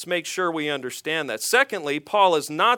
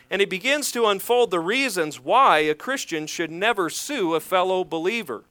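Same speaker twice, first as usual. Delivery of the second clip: ordinary talk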